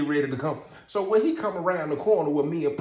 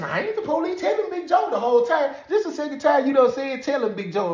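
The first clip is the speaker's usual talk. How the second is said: high, loud joking guard voice; officer teasing the inmate's father; guard protesting; 11 semitones higher, 4 dB louder than usual. Retold or imitated. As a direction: play the guard teasing and insisting. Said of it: "He said".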